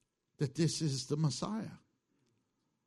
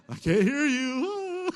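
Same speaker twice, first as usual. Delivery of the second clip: laughing mock deafness; 8 semitones higher, 7 dB louder, slower than usual. Joking about losing hearing.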